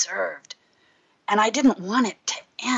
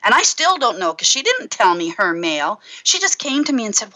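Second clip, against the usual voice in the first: usual speaking voice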